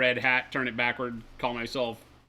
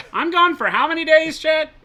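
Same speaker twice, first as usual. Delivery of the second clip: much higher and louder, hammed-up indignation; mock outraged shout; 12 semitones higher, 11 dB louder than usual. Co-host complains to Chet about his absence, mock outraged shout. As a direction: throw a fake tantrum about being gone.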